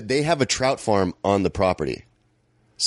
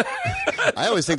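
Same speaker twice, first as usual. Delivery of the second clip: laughing; slower than usual. Real, not acted. Laughing at own cockiness.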